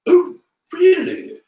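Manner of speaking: high, slow, loud drawl. A narrator's reading. Draco seizing on Harry's challenge, drawling loudly. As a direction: drawl it out for all to hear. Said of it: "in the loudest drawl Harry had ever heard".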